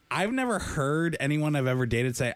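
usual speaking voice

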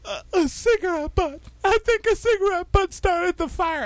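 high, tearful crying confession; crying woman. Retold or imitated. Barefoot neighbour to narrator, crying high. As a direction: sob it out high and breathless. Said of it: "she's crying".

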